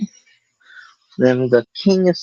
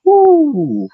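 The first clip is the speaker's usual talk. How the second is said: high, loud woo; excited whoop; 4 dB louder than usual. Real, not acted. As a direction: cheer it out. Delight at a clean peel.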